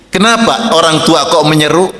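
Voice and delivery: ordinary talk, nothing marked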